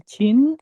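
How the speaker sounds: "cin" with a puff of air on the ch; aspirated C demo. Shown as a demonstration. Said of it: "Jadi yang satunya ada hanya".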